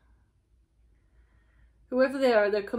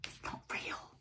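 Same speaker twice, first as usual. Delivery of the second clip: real unvoiced whisper, faster; 14 dB quieter than usual. Real, not acted. Reader noticing she's whispering to viewers, real whisper. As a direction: catch yourself whispering and laugh. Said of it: "I don't know why I'm whispering".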